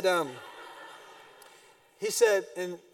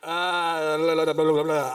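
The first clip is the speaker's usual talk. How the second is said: flustered mock babble; 6 dB louder than usual. Husband fumbling excuse.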